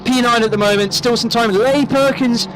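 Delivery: play-by-play commentary. Real, not acted. Live race commentary calling laps.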